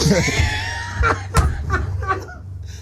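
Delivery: very high, squealing real laugh; helpless laughter. Real, not acted. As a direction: crack up completely, breathless with laughter. Both crack up at the story.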